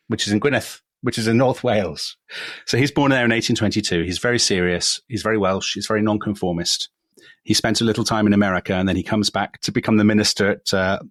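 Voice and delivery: usual speaking voice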